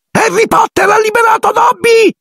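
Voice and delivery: piercingly high, joyful elf shriek; Dobby, shrieking. A narrator's reading. Elf looks up at his liberator Harry, shrieking. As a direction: squeal it out at the top of your pitch. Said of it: "strillava l'elfo".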